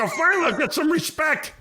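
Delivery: high, mock-proud cry of vindication; hammed-up delight at last getting credit; mock triumph. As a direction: crow it like you've finally made it, tongue in cheek. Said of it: "It's like".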